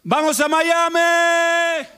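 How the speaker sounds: a high, slow, pompous commander's call; the commandant. Retold or imitated. Commandant proposing Miami to the group, high and slow. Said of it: "aparece el comandante y dice".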